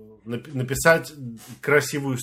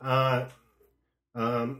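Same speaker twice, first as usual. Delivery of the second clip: ordinary delivery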